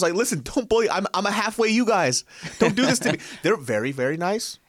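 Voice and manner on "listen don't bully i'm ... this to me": pleading. Retold or imitated. Pleading not to be bullied.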